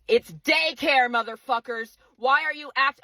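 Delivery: real angry insistence; mad. Real, not acted. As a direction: lean hard and high on the words, really mad. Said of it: "I'm so fucking mad".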